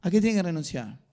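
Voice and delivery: firm, projected question to the congregation; forceful question. Real, not acted. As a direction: push the question out firmly from the start. Asks the same question more forcefully.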